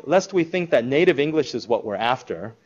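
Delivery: ordinary talk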